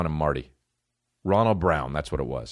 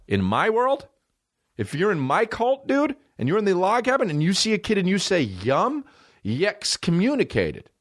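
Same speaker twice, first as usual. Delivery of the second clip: mock cult leader; 10 semitones higher than usual. Pretend cult leader ruling.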